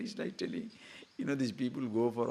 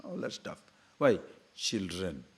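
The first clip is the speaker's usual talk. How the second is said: usual speaking voice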